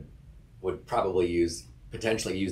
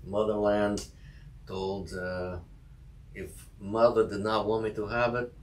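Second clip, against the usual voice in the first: mock Russian accent, rising on the "mother" lines; deadpan the joke in a thick Russian accent; 2 semitones higher than usual. Cohost riffing on a strict mother, in a mock Russian accent. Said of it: "you do Russian accent better".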